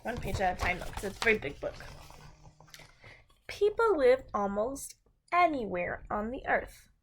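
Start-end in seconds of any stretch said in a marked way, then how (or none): 3.5-6.9 bright, clear storybook read-aloud; reading to children. A narrator's reading. Teacher reads a food book to her class, storybook voice.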